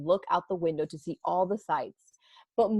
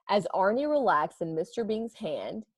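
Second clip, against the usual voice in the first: ordinary delivery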